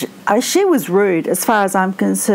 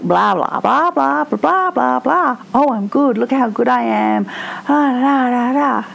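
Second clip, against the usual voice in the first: higher-pitched babble imitating a show-off; mock boastful chatter; 3 semitones higher than usual. Mimicking a woman talking to a man, mock boastful chatter. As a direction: rattle on, mocking her self-praise. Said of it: "When she's talking to a man, she's like".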